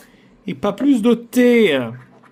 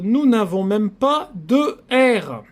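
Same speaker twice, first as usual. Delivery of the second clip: ordinary delivery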